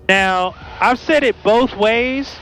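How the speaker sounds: usual speaking voice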